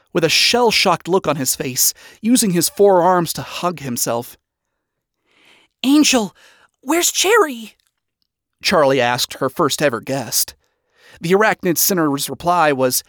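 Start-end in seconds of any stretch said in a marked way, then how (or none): 5.8-7.7 high, urgent question; worried question. Acted. Charlie to her shell-shocked first guest, worried. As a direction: ask urgently after a missing friend.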